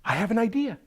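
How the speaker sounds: high farmer voice, excited; Saeed's bright idea. Acted. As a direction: burst out with a sudden idea, pitched high. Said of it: "Said said".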